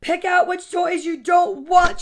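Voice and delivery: slow, loud, high command; ordering mom. A narrator's reading. Mom making son sort his toys, loud ordering. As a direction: issue it as a firm order. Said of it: "she ordered".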